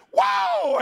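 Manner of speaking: high-pitched whooped war cry; battle shout. Acted. Preacher acting David shouting for the battle, high battle shout. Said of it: "And so he shouted".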